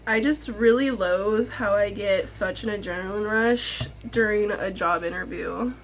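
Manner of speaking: ordinary delivery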